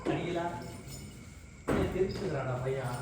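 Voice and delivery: low, hushed aside; wary aside. Acted. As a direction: drop right down, as if confiding. Wary aside after expecting a beating.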